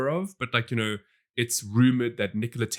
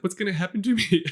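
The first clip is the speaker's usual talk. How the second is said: higher-pitched mock-tearful question; mock crying kid; 7 semitones higher than usual. Retelling the orphaned kid at the end, mock crying. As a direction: lightly imitate a crying child, half laughing. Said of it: "he's, like, crying".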